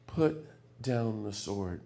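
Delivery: slow, soft divine command; solemn commandment. Retold or imitated. Jesus quietly commanding Peter to drop the sword, slow and solemn. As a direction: lay it down quietly with full authority. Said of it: "the commandment comes down".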